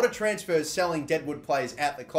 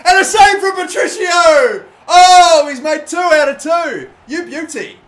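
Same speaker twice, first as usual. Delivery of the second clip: soaring, loud shout of joy at the save; excited shout; 13 semitones higher, 16 dB louder, slower than usual. Real, not acted. Gamer cheering his keeper's save, loud excited shout. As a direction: yell it like the keeper just won it.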